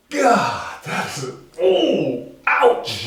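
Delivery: slow, pained sympathy; wincing village. Acted. Village hears of his syphilis, slow and pained. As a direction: draw it out as if wincing. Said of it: "The village says".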